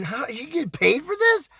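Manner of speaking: high-pitched acted disbelief, rising at the end; incredulous. Retold incredulous thought.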